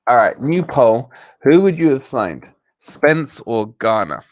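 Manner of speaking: loud, emphatic announcer delivery; poll announcement. Real, not acted. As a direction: call out the new poll to the viewers. Announcing a new poll to viewers.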